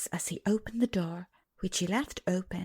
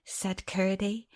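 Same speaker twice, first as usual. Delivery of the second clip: usual speaking voice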